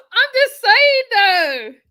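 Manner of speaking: high loud laughing protest; loud laughing just saying. Real, not acted. Laughing at her own point.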